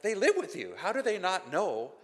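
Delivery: high, incredulous challenge. Acted. Preacher challenging firefighters who hide their faith, incredulous. Reacting to hidden Christians, incredulous.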